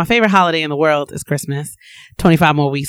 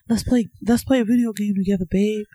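mock husband voice; 3 semitones higher, 4 dB quieter, faster than usual. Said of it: "I don't talk like that".

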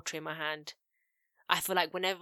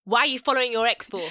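higher, louder mock demand; voice raised; 5 semitones higher, 8 dB louder than usual. Shown as a demonstration. Co-host acting out how to confront a boyfriend, voice raised. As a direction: raise the voice, pressing the question. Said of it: "my voice raised a little bit".

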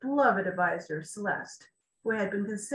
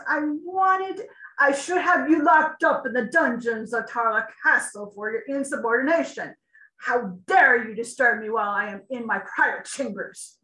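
loud, indignant royal rebuke; angry king; 3 semitones higher, 7 dB louder than usual. A narrator's reading. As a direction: threaten him with the dungeon. King rebukes chancellor angrily.

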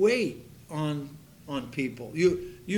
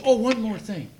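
calling out; 6 semitones higher, 4 dB louder than usual. Retold or imitated. Teasing call to the waitress.

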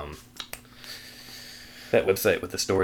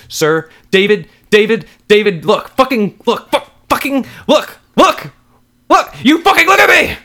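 frenzied shouting climbing to a piercing shriek; escalating scream; 15 dB louder than usual. Acted. Wife demands David look at her, escalating scream. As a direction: stammer with rage into a full scream.

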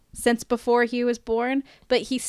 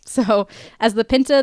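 normal talking voice

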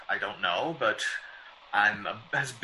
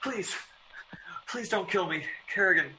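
terrified pleading; 8 semitones higher than usual. Acted. Writer begging the killer to spare him. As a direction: beg for your life.

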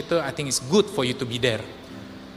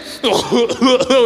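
loud, high, broken gasps; mimicked gasping for air; 8 semitones higher, 7 dB louder than usual. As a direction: act out choking for breath on a tiny inhale. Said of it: "tarik nafas dikit ya". (meniru napas tersengal-sengal)

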